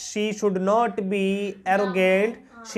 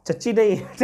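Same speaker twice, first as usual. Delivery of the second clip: laughing; 3 semitones higher, 4 dB louder than usual. Real, not acted. Teacher laughs at a mispronunciation.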